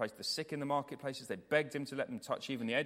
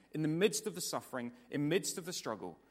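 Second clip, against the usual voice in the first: ordinary talk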